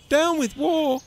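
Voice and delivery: high, hooted slogan; high-voiced little protester; hooting. A narrator's reading. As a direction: hoot it in protest. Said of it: "he hooted".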